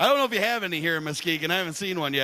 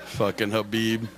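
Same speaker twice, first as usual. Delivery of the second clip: ordinary talk, nothing marked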